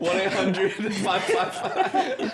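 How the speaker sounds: put-on high, slow, laughing Spanish accent. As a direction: play a lawyer ad in a Spanish accent. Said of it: "Spanish accent".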